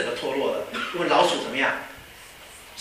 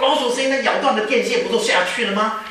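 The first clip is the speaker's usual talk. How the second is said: higher, mildly panicked exclamation; acted alarm, pitched up; 6 semitones higher, 4 dB louder than usual. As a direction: react in mild alarm as the acted pilot. Pilot alarmed at mouse noise.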